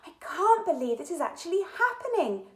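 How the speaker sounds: put-on high, loud, thrilled girl's voice; a child who can't believe her luck; excited cry. A narrator's reading. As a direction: burst out with excitement. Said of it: "cried Katy".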